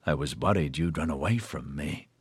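soft, mocking menace; gloating. A narrator's reading. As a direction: purr the threat with relish. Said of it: "a long talk voice gloated".

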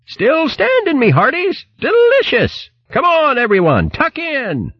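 high, hearty hare calling out. Acted. Hare calling shipmates to feast on fruit, high jolly voice. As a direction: sing out high and gleeful, relishing each word. Hare calls crew to eat.

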